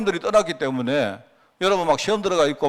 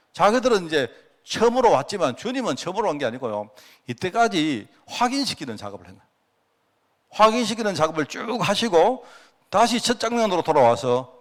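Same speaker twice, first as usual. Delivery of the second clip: ordinary delivery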